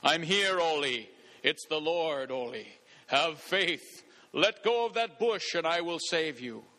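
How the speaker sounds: lightly voiced voice of the Lord; a hint of a deep, commanding God; deep ringing voice. The Lord answers a dangling man, lightly acted deep voice. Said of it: "he hears a deep voice ring out".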